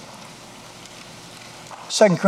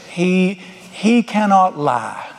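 slow emphasis; much slower than usual. Acted. Preacher slows for key truth.

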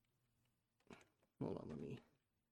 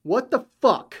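loud, higher angry cry; 7 semitones higher, 6 dB louder than usual. Acted. Abandoned worker shouting at the maintenance man, furious. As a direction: explode in disbelief.

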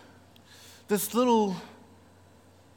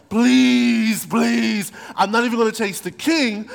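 begging Haman; 4 semitones higher, 4 dB louder than usual. Retold or imitated.

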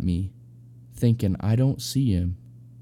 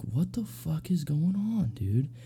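high-pitched, slow, mock-baffled question; hushed disbelief, pitched way up; retold bewilderment; 8 semitones higher, 4 dB quieter, slower than usual. Retold bewildered reaction to roommate's moaning.

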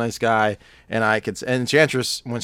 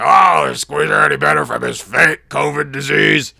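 high, loud shouted mockery; hammed-up bellowing impression; Pat impression; 5 semitones higher, 10 dB louder than usual. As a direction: yell it as an overblown caricature. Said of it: "That's my Pat".

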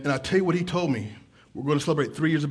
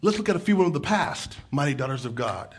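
ordinary talk, nothing marked

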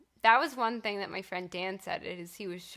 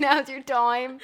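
slow, much louder echo through laughter; laughing Boston echo; 5 semitones higher, 11 dB louder, slower than usual. Pretend. Echoing the Red Sox cheer in a laughing accent. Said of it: "Boston accent".

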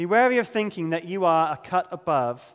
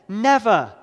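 "never" said loud and falling; stressed never; 2 semitones higher, 5 dB louder than usual. Shown as a demonstration. Preacher pointing out Peter's repeated word, loud stress. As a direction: lean hard on "never". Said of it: "Notice there again".

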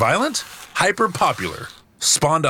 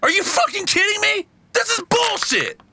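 furious outburst. Real, not acted. Losing at Connect Four, furious.

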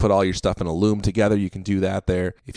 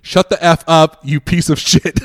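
loud, higher mock-angry retort; imitated cussing out; 7 semitones higher, 5 dB louder than usual. Dad of the kid to the heckler, mock angry outburst. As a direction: fire back like an outraged parent, amused underneath. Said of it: "cussing him out".

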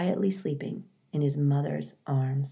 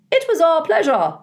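very high, loud chirpy bird voice; smiling sparrows; 13 semitones higher, 7 dB louder than usual. Acted. Sparrows saying goodbye to the robot, high and chirpy. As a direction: sing it out like a flock of sparrows. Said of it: "said a flock of smiling sparrows".

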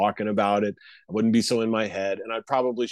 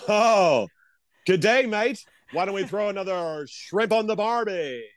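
high, hammed-up Australian accent; playful Australian tourist voice; mock Australian accent; 8 semitones higher than usual. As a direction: ham up a cartoon Aussie. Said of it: "lovely accent you have".

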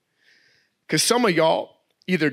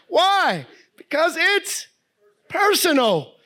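much higher, louder punchline; emphatic refrain; 12 semitones higher, 3 dB louder, slower than usual. Acted. Preacher driving home his refrain to the congregation, emphatic. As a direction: land it like a preacher's climax.

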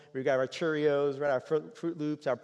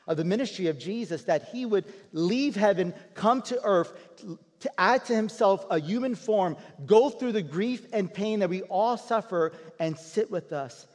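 louder and higher, building into full preaching; rising preaching; 6 semitones higher, 6 dB louder than usual. Acted. Pastor preaching to the congregation about Jesus, swelling fervour.

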